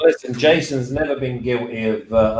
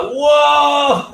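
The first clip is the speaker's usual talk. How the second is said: high shocked whoa, pitched way up; 14 semitones higher, 5 dB louder than usual. Retold or imitated. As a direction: gasp it out in mock shock. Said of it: "and he's like".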